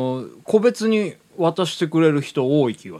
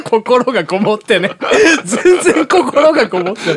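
laughing tease; 8 semitones higher, 6 dB louder than usual. Real, not acted.

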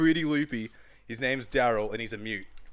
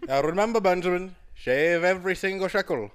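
higher, louder doting elder; 6 semitones higher, 5 dB louder than usual. Pretend. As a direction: fuss over Benjamin like a grandfather.

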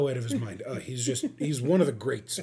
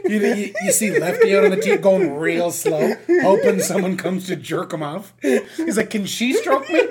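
laughing; 6 semitones higher, 7 dB louder than usual. Real, not acted. Joking about Lefty, laughing.

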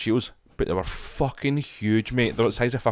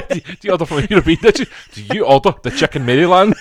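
higher and louder, teasing through laughter; mock teasing; 7 semitones higher, 7 dB louder than usual. Real, not acted. Mate mocking mate's takeaway order, teasing and laughing. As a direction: tease your mate's order.

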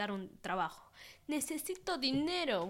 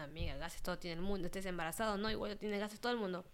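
normal talking voice

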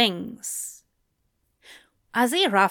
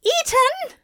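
very high, louder shrill "Eaten?"; 4 dB louder than usual. A narrator's reading. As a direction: shriek it out in alarm. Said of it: "blurted out a shrill".

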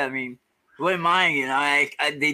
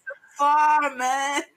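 far away voice; 11 semitones higher, much slower than usual. Retold or imitated. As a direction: call from the far corner. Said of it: "You sound so far away".

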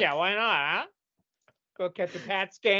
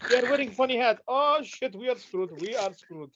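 alarmed locals; 5 semitones higher than usual. Pretend. Locals spotting Puritans landing, alarm fading to dread. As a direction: panic at the funny hats, then give up. Voicing natives seeing Puritans arrive.

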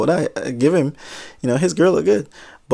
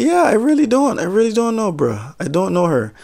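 much higher, insisting I really don't; eager high denial; 8 semitones higher than usual. Retold or imitated. Clerk insisting he doesn't know the girl, high. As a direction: blurt it out, desperate to be believed.

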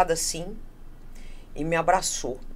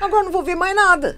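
laughing complaint; 12 semitones higher, 8 dB louder, faster than usual. Retold or imitated. Curtain closed, laughing retelling.

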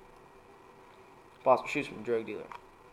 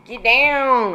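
sudden loud high yell of "Get down!"; 11 semitones higher, 12 dB louder than usual. Acted. Secret Service agent shielding the president, shouting. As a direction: bark "Get down!" like a bodyguard. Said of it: "isn't allowed to yell".

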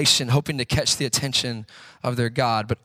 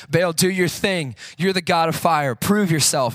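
raised, higher-pitched mock shout; 5 semitones higher, 4 dB louder than usual. Prophets of Baal shouting to their god, mock shout. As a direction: call out to Baal like an impatient crowd. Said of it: "they start shouting to, shouting to Baal".

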